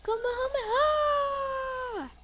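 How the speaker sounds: slow, high battle cry; drawn-out attack shout. Acted. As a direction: stretch the attack name out high. Calling out special attack.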